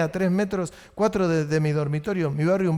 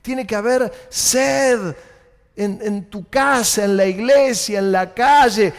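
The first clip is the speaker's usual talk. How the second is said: slow, building to louder on each place; preaching with power; 2 semitones higher, 3 dB louder, slower than usual. Shown as a demonstration. Preacher urging the church, slow and rising. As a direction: stretch out the thirst, then hammer each place. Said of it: "Voy a tratar de hablar con poder".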